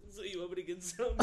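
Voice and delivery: high, squeezed mimicry of a friend; imitating Chris. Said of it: "Chris, you were just like".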